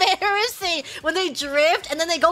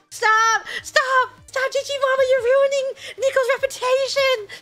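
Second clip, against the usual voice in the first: high, wailing comic plea; mock distressed pleading; 9 semitones higher than usual. Fan begging mom to spare Nico's reputation, mock distressed. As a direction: beg in hammed-up despair, near laughter.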